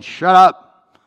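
sudden loud, high shout; stern silencing. Acted. Crowd silencing the blind beggar, sternly shouting. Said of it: "sternly telling him to be quiet".

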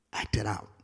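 breathy whisper; whispered to the congregation; whispered aside. Real, not acted. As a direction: drop to a whisper for emphasis. Soft aside in sermon.

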